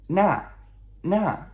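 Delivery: "那" as na, starting high and falling; two separate, firmly dropped takes of "那"; fourth tone. Shown as a demonstration. Teacher drilling the word for that, fourth tone. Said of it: "fourth tone descending".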